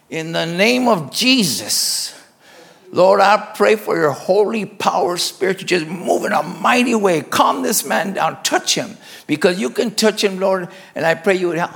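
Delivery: acted-out intercession, pressing and earnest; fervent prayer. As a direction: pray aloud with urgency over someone in distress. Acts out praying aloud over patient.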